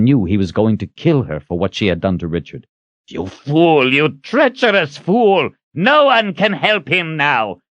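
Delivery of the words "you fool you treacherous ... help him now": high, raging accusation; out-of-control rage. A narrator's reading. Zed about to kill Kalen, raging. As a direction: hurl the words in fury. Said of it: "a wizard in a rage so out of control".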